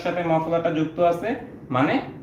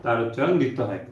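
slow, lower dictation pace with long gaps; 3 semitones lower, 4 dB quieter than usual. Acted. Teacher dictates the second rule to students in a slow dictation voice. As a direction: read the rule out slowly so the class can write.

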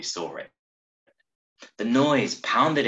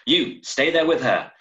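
firm order; 5 semitones higher, 5 dB louder than usual. Acted. Constable gives an order.

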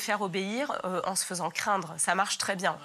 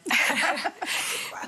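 breathy laugh; 7 semitones higher than usual. Real, not acted. Laugh at belly joke.

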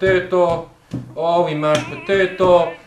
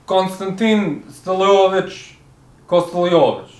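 Russian TV chef with slow, deliberate delivery; comic Russian accent, drawn-out name; put-on Russian accent; 4 dB louder than usual. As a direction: introduce a cooking show in a thick Russian accent. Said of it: "It sounded Italian".

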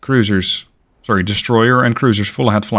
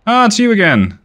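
excited greeting; 10 semitones higher, 4 dB louder than usual. Acted. Greeting a familiar enemy ship.

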